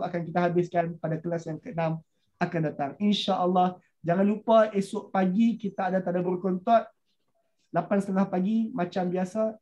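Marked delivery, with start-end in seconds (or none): none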